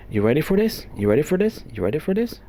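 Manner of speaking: excitedly asking viewers if they're ready. Real, not acted. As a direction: tease the big reveal with rising glee. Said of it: "emotional and excited over here".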